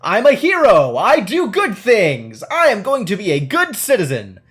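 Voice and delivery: loud, high hammed-up hero declaration; mock-heroic proclamation. Mock-heroic declaration of player's choice.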